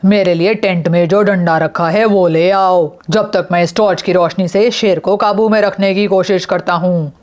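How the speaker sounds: plain reading voice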